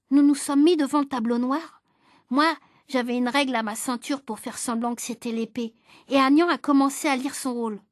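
ordinary delivery, nothing marked

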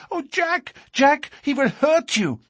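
very high, frightened woman's voice; distraught cry. A narrator's reading. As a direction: plead frantically with a sweetheart. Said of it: "cried poor, distracted Etty".

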